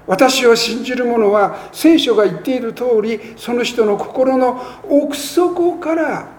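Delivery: scripture reading. Acted. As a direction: lift the verse up to the congregation. Scripture read aloud in sermon.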